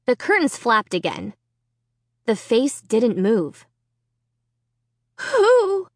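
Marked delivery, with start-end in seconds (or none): 5.2-5.9 high, strained frightened gasp; choked out. Acted. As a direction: force the word out through fear. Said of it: "I choked out".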